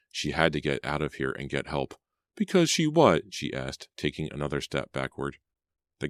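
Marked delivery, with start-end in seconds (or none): 2.4-3.3 much higher, louder pressing question; frightened demand. Acted. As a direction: push him fearfully for the answer. Pam presses the guy.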